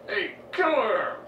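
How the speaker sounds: high, drawn-out dad holler; mock stern call-out. Stern father stops everything, acted retelling.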